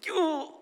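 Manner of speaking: high pained "Ooh" of a stubbed toe; mock pain yelp. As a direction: yelp as if you just stubbed your toe.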